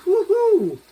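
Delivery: high, loud happy whoop; excited woo-hoo. Real, not acted. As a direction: cheer it out, thrilled. Excited by the smell.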